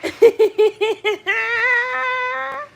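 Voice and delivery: high loud burst of laughter; howling laugh. Real, not acted. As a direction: cackle out loud.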